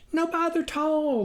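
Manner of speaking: high, eager country wife; farmer's wife insisting. A narrator's reading. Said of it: "the farmer's wife insisted".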